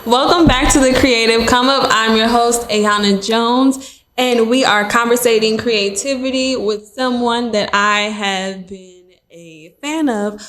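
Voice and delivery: usual speaking voice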